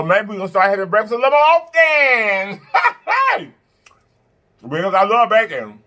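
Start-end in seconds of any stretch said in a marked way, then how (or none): 2.6-3.4 short high-pitched laugh; a quick squeal of laughter. Real, not acted. Laughing at own plan.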